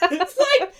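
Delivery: ordinary talk, nothing marked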